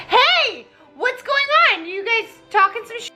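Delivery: high, eager busybody. Retold or imitated. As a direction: chirp it up high. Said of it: "Aaron Burr's like".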